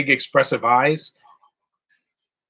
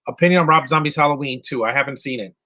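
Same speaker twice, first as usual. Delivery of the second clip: ordinary talk, nothing marked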